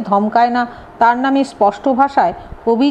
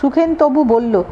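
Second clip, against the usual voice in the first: usual speaking voice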